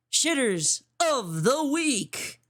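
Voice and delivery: slow, stretched announcer read of the title; announcer drawl. Acted. Host to stream viewers, slow announcer drawl. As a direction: draw it out like a show host.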